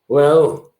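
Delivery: put-on dad voice, deadpan payoff; dad impression. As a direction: deliver the jibe as dad, pausing after the first word. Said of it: "he looks at me and goes".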